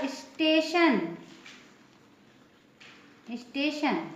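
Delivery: "स्टेशन" with a sh before the final n; "स्टेशन" modelled for learners with a clear sh; TIO as "sh". Shown as a demonstration. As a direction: say "स्टेशन" as is-TE-shan. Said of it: "इसका pronunciation क्या होगा".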